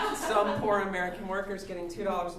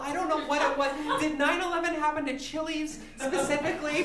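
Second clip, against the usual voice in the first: ordinary talk, nothing marked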